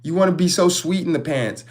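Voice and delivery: ordinary talk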